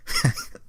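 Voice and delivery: a sudden squeaky giggle burst; high giggle. Real, not acted. Laughing breaks the boast.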